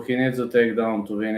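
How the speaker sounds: usual speaking voice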